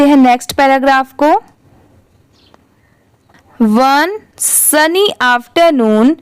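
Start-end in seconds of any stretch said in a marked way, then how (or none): 3.6-6.1 very slow, word by word; slow reading demo. Shown as a demonstration. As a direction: read it out slowly for beginners. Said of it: "उसके बाद का यह paragraph है".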